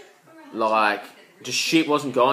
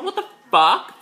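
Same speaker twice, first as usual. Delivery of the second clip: high-pitched shocked "What the fuck?", much higher; shocked disbelief; 6 dB louder than usual. Retold or imitated. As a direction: squeal it in disbelief. Shocked reaction, repeated louder.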